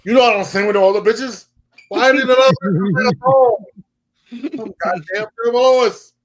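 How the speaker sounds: high, loud imperious diva; mock diva rant. Guest imitating the star fuming at another singer. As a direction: play the star offended at a wedding. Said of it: "She was like".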